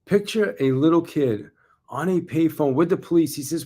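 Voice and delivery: measured, building stage voice; keynote delivery. Shown as a demonstration. Speaker rehearsing full keynote for salespeople, stage-style motivational delivery.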